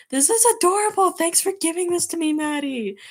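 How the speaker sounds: high, loud fangirl squeal easing down; very high, excited gushing; delighted gushing. Real, not acted. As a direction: squeal with delight, then settle into thanks. Gushes over the fan fiction.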